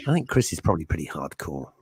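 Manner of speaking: usual speaking voice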